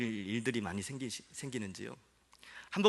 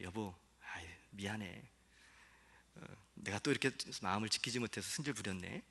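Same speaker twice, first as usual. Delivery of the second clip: soft, lower, sorry tone to wife; sheepish apology; 5 semitones lower, 8 dB quieter than usual. Retold or imitated. Pastor voicing his apology message to his wife, soft and sheepish. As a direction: apologize softly, a little embarrassed. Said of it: "문자 메시지를 보내요".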